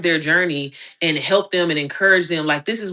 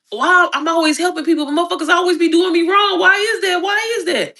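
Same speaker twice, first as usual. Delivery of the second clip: high, whining complaint to God; mock-wounded begging that climbs high; plaintive plea to God; 10 semitones higher, 3 dB louder than usual. Retelling her complaint to God, a high whine. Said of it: "conversation with God".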